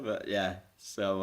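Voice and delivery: normal talking voice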